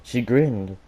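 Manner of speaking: plain reading voice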